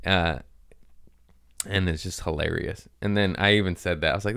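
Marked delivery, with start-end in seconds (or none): none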